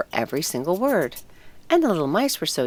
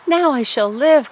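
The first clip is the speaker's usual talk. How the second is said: higher, louder cry of joy; joyful cry; 9 semitones higher, 7 dB louder than usual. A narrator's reading. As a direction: burst out with relief and delight. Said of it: "cried the tree joyfully".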